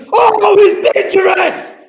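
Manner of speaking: high, loud goal shout; long goal yell. Real, not acted. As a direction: scream the goal call.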